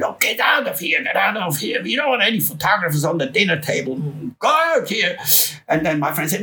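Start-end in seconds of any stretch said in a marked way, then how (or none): 0.0-1.0 higher, dismissive shoo; imitating a gruff band member; mock rock star. Band shooing off photographer. 1.0-5.2 higher, dismissive shoo; imitating a gruff band member; mock rock star. Band shooing off photographer.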